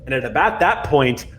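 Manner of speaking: ordinary talk, nothing marked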